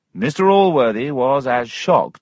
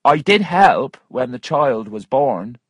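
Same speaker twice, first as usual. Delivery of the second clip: lightly voiced woman, pitched up; a higher, firm admission in character; insistent Missus Waters; 5 semitones higher, 5 dB louder than usual. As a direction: insist in a slightly raised voice.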